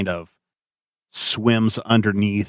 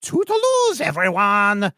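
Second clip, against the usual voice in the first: very high, sing-song goodbye; Doctor West farewell. Acted. Doctor West calling to the party from the narwhal, very high. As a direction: trill it out as he rides off.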